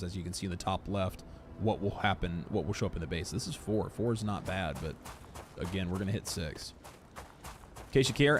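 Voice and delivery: ordinary talk, nothing marked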